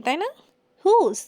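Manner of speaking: ordinary talk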